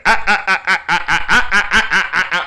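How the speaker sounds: repetitive staccato mock laugh, "ah ah ah"; Joker laugh. Imitating the laugh at song's end, staccato mock laughing. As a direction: imitate a villain's mechanical looping laugh. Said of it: "the Joker's, uh, that little Joker thing".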